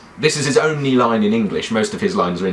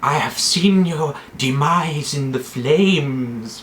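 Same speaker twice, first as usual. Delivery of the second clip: slow, higher prophetess voice; drawn-out, accented mystical prophecy; Melisandre; 5 semitones higher, slower than usual. Acted. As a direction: intone it like a red priestess. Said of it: "the Red Woman".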